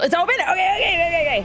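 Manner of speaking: high, loud burst of excitement; squealing it's open, okay okay; excited shout. Real, not acted. Game opens, excited outburst.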